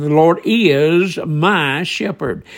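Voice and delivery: slow, drawn-out "is" and "my", each set apart; very emphatic. Retold or imitated. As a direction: stretch "is" and "my" as a firm declaration. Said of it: "very emphatically".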